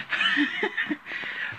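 a burst of airy, high-pitched laughter; helpless giggle breaking character; high breathless giggle. Real, not acted.

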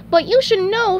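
high, loud chipper voice; SpongeBob voice. Acted. Turning back to his co-worker, high chipper afterthought. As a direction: pipe up in a high cartoon voice.

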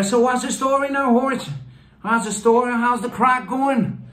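high-pitched hammed-up North Dublin Irish accent. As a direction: greet a mate like a North Dublin local, high and playful. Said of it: "That's a type of Irish".

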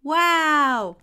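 loud, high drawn-out wow; amazed gasp. A narrator's reading. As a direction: gasp out in wonder like children. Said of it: "gasped the children".

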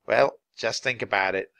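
plain reading voice

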